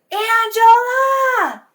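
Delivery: high, loud, drawn-out call; distant yell. Retold or imitated. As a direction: holler the name as if from far off. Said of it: "yelled out to me".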